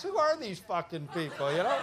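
ordinary talk

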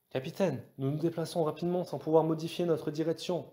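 high-pitched, urgent navigator; urgent report. A narrator's reading. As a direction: call it in with eager urgency. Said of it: "déclara Kosa avec empressement". (voix aiguë, empressée)

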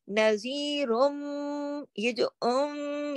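"नज़ीरुम" slowly, then "उम" as a long nasal hum; ghunnah demonstration. Shown as a demonstration. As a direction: hold the m of "नज़ीरुम" and "उम" through the nose. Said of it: "ये गुन्ने की आवाज".

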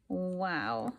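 ordinary delivery

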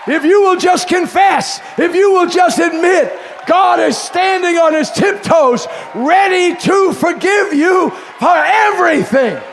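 high shouted plea; a preacher calling sinners to confess; full-force preaching. Acted. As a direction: lift them up with the promise.

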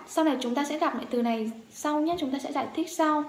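ordinary talk

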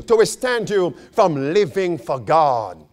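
ordinary talk, nothing marked

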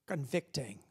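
usual speaking voice

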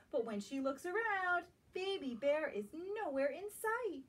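gentle, lilting storybook read-aloud; reading to children. Acted. Teacher reading a picture book to young children, lilting. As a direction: draw little listeners into the story.

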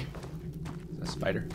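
breathy scared whisper; hushed nervous whisper. Real, not acted. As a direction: whisper it, afraid of spiders. Scared aside.

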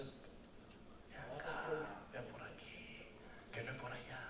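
near-whispered gentle call; soft coaxing. Pretend. Shepherd to the sheep at the cliff, soft coaxing. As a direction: coax the sheep back quietly.

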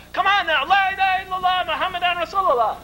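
high, insistent rallying cry; retold shouted urging. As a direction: egg the crowd on into the creed. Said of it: "Again we shouted it".

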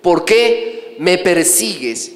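very slow, drawn-out question, falling at the end; slow, weighty divine question, lightly voiced; questioning voice from heaven. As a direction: stretch each word of the question. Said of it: "luego viene la pregunta".

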